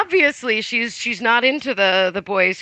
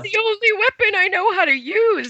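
mock pleading excuse; 9 semitones higher, faster than usual. Voices the villain's whiny excuse.

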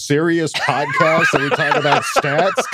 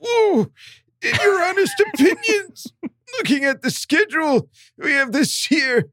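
put-on high, strained pained old man; old man just hit in the groin; 6 semitones higher than usual. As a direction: read it like you just got kicked in the nuts. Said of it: "He wanted an old man that got kicked in the nuts".

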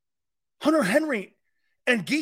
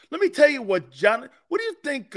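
ordinary delivery

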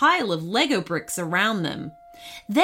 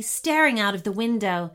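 ordinary delivery

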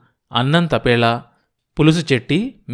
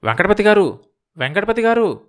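high, anxious calling; higher-pitched, worried call; flustered; 8 semitones higher than usual. A narrator's reading. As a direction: call his name in alarm. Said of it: "గాబరాగా పిలిచాడు".

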